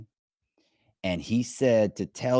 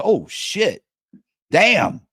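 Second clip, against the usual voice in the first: higher, louder caught-off-guard outburst; startled; 10 semitones higher, 6 dB louder, much slower than usual. Retold or imitated. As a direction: blurt it out, caught completely off guard. Stranger learns he is Matt.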